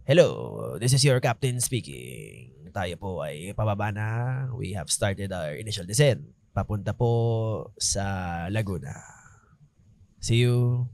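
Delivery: low, measured captain over the radio; Gas Abelgas voice. Retold or imitated. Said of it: "yung Gas Abelgas voice ko".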